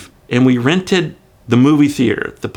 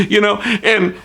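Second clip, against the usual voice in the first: normal talking voice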